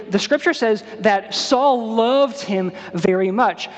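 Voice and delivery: ordinary talk, nothing marked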